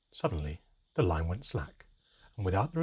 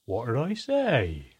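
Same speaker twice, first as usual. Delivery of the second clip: smiling; 4 semitones higher, 6 dB louder, slower than usual. A narrator's reading. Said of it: "smiling widely at me".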